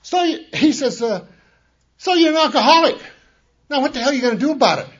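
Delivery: high, loud, fast shouted challenge; yelling. Retold or imitated. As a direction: bellow it across the room at the newcomer. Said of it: "he stands up and yells at me".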